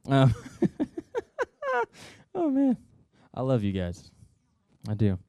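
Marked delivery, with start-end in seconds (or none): none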